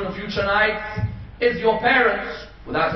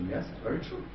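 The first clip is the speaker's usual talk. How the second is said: hushed admission; 5 semitones lower, 11 dB quieter, faster than usual. Retold or imitated. Bahlul calmly admits to caliph.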